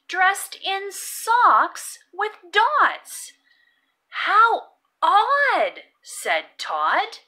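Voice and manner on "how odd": higher, louder puzzled exclaim; bright wondering exclamation; surprised "how odd". Acted. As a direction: marvel at the odd sight. Todd remarks on odd sight.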